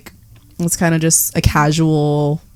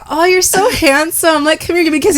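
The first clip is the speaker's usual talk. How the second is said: sweet flirting; 9 semitones higher than usual. Retold or imitated. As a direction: coo sweetly at him. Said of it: "I was like".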